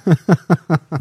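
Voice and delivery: a big, loud laugh bursting out; loud burst of laughter. Real, not acted. Asked if he does this podcast, a loud laugh. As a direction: laugh out loud, caught off guard.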